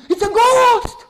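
high loud terrified cry; scream. Acted. Disciples in the boat scream at Jesus in terror. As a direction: shriek in fright. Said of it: "they scream".

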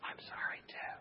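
hushed, voiceless apology; whispering back. Retold or imitated. Patient finally apologizing to preacher, whisper. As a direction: whisper it, suddenly meek. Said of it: "Started to whisper back to me".

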